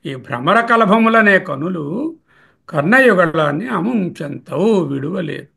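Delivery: ordinary delivery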